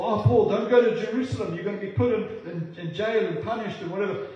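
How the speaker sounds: high, urgent warning; alarmed pleading. Acted. Friends plead with Paul, acted.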